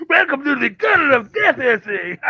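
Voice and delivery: high, loud mock carnival host; spooky announcer. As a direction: announce it like a grim ride host. Mock welcome to death tunnel.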